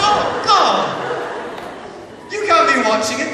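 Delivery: high, loud, laughing horrified exclaim; shocked. Retold or imitated. Actor reacting to a sweet grandmother fan, loud mock shock. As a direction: recoil in mock horror with a laugh.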